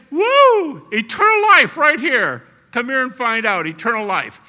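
very loud, high joyful cry; excited shouting. Shown as a demonstration. Preacher showing how loudly to proclaim eternal life, shouting joyfully. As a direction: shout it out like a big catch. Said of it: "what should I be like to say".

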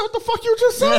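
high-pitched disbelief, voice jumping up; shocked father can't believe his ears; stunned outrage. Retold or imitated. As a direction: retell the shock like it just happened. Dad retelling angry reaction to son.

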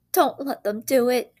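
high, loud wail; wailing child. A narrator's reading. Boy wails at the ceiling, loud and high. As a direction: plead like a desperate boy. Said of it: "wailed Peter".

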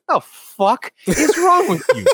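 slow, high mock-furious dad; imitated angry dad. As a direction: burst in as an exasperated dad, half laughing. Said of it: "dad, in the middle of the argument".

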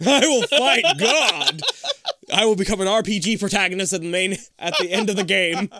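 hammed-up heroic declaration; epic vow. Laughing mock heroic vow.

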